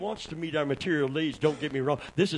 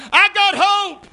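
high and loud call to the church; shouted preaching; 12 dB louder, slower than usual. Acted. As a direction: lift the church with it. Preaching peak.

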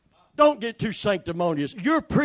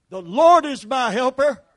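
loud declaration; 6 semitones higher, 8 dB louder than usual. Shown as a demonstration. Preacher to the congregation, a loud declaration. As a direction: call it out strong and high. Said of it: "So say it".

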